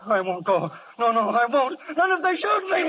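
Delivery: high, loud, panicked cry; panicked refusal. Acted. Deserter screaming refusal at the lieutenant, loud high panic. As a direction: scream that you won't go back.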